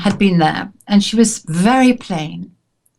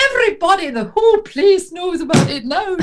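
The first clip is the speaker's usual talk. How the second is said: high, mock-exclaiming big voice; imitated big voice; 8 semitones higher than usual. Imitating a woman overheard by the whole restaurant, high big voice. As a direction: call it out high, imitating a hooting diner. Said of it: "she had this really big voice".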